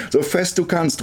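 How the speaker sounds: high, eager cry; urgent call. A narrator's reading. Girl on swing demands harder pushes, calling out. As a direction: call out high, urging him on. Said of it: "außer Atem und ihre Stimme ist fast heiser".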